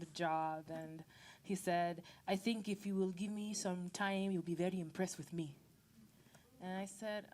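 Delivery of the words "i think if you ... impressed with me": put-on Nigerian accent of a hopeful suitor; mock Nigerian-accented suitor. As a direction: imitate a Nigerian caller wooing someone. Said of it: "this is me in my best Nigerian accent".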